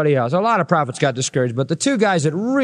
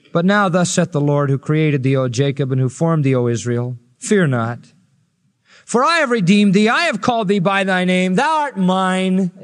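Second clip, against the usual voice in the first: scripture read aloud. Acted. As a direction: proclaim it like God speaking. Reads Isaiah with full force.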